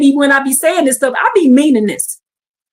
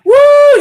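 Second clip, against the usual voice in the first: high, loud whooping scream; joyful scream; 13 semitones higher, 6 dB louder than usual. Real, not acted. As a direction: let out a big woo. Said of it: "Y'all think I'm screaming now?".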